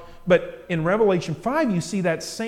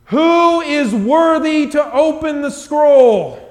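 booming, slow, much higher call to all heaven; loud proclamation; 9 semitones higher, 12 dB louder, slower than usual. Acted. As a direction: proclaim it like a mighty angel. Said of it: "proclaims in a loud voice".

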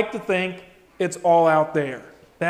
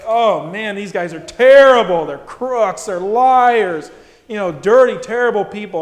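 louder, hammed-up griping about crooks; mock outraged rant; 9 dB louder than usual. Churchgoers griping about politicians, mock outraged rant. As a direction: play the indignant churchgoer, loud and scornful.

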